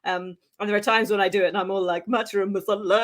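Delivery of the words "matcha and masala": "masala" in a playful Indian accent; put-on Indian accent. Shown as a demonstration. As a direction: lean into an Indian accent for the words. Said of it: "do it in a accent".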